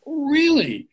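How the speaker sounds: high, loud offended echo; indignant really. Acted. Daryl answers his doubting friend, high and offended. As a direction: throw the word back, stung.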